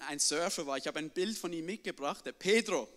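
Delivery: usual speaking voice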